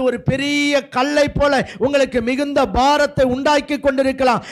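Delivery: high, driving sermon voice building to a peak; impassioned preaching. Real, not acted. Preacher urging believers that stones will be rolled away, impassioned preaching. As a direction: preach it with mounting force.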